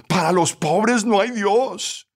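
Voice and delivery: high, slow, bitter defiance; wounded woman's drawn-out angry retort; lioness fury. A narrator's reading. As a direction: declare it in acted indignation. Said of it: "se volvió como una leona".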